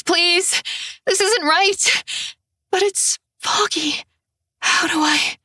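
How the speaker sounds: high, frightened pleading, breaking; trapped and terrified; panicked pleading. Acted. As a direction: beg in terror, voice climbing. Strapped in, panicking in solitary.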